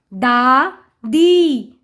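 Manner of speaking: दा and दी slow and separate; sounding out. Shown as a demonstration. Teacher reading to children, syllable by syllable. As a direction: stretch both halves: दा... दी. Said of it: "उच्चारण करके पढ़ लिया".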